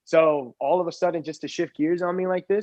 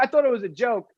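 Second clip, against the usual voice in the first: ordinary talk, nothing marked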